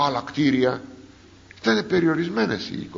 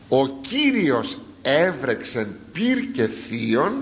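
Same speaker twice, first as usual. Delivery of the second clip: slow scripture reading; slower than usual. Acted. Slow emphatic scripture quote.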